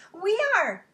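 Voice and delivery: put-on high, loud children's cheer; kids' reply. Students answering their teacher, high and loud. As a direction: call it out like eager kids.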